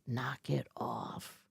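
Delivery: low, slow, weary mom voice; tired mom. Retold or imitated. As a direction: brush them off like a worn-out mom. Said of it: "my mom's like".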